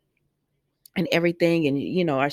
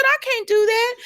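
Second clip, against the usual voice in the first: much higher, outraged no; indignant refusal. Retold or imitated. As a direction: shoot it down in shocked disbelief. Gabby refuses Ava.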